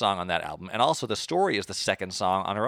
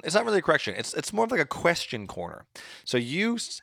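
ordinary talk

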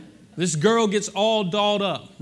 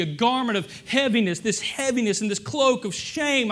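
rising, louder preaching cry; a believer's testimony, preached with rising fervour; 3 semitones higher, 4 dB louder than usual. Acted. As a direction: preach it out, building higher. Preacher voicing a redeemed believer.

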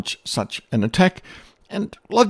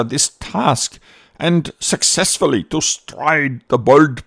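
hyped-up propaganda speech; 5 semitones higher than usual. Retold or imitated. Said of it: "when he said".

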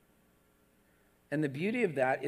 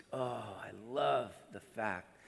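softer, lower tender voice of Jesus; 8 semitones lower, 6 dB quieter than usual. Pretend. Preacher voicing Jesus's tender love for his child. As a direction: murmur with fond love. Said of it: "he smiles from ear to ear, just".

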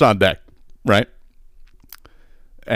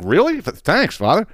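surprised sinner; 10 semitones higher than usual. Acted. Sinner surprised at light penance.

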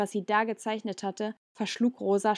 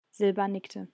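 usual speaking voice

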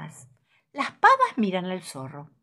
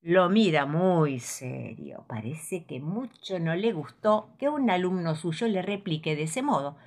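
usual speaking voice

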